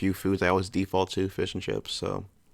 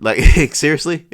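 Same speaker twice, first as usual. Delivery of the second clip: seriously? pushed out higher and much louder through a laugh; laughing disbelief; 7 semitones higher, 11 dB louder than usual. Real, not acted. Reacting to curry being Britain's top dish, laughing disbelief.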